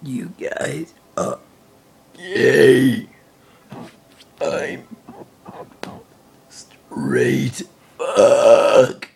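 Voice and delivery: low, slow words pushed out on a burp. Shown as a demonstration. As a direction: talk through one long burp. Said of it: "I'm gonna do another burp video".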